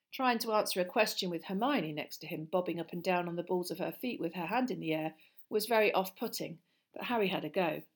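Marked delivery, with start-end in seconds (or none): none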